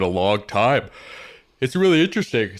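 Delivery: talking through a yawn; words stretched by a real yawn. Real, not acted. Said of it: "Sorry, I keep yawning".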